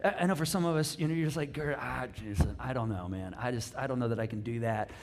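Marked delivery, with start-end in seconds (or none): 1.5-4.9 low, hesitant mumbled excuse, lightly acted; imitated reluctant guy. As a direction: play a guy backing off, pitched down. Voices reluctant guys hesitating.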